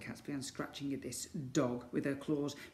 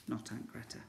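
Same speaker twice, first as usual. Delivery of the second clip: ordinary delivery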